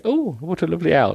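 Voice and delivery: a hint of a doting woman; mock lady's voice. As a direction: coo over the owl. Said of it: "she just had an owl on her hand going".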